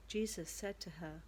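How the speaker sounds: usual speaking voice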